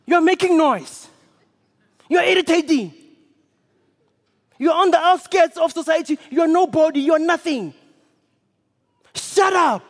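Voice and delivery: loud, high, contemptuous scolding. Acted. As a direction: crowd telling the beggar off. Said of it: "they scolded him".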